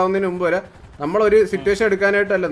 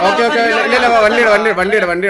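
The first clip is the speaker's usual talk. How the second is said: loud, raised-pitch repeated orders; urgent shouting; 4 semitones higher, 7 dB louder than usual. Real, not acted. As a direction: shout over everyone to get them moving. Urging mates to the vehicle. (ഉച്ചത്തിൽ തിരക്കിട്ട ആജ്ഞ)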